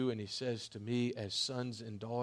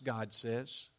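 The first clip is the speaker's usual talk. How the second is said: ordinary delivery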